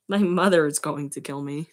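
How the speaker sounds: ordinary delivery, nothing marked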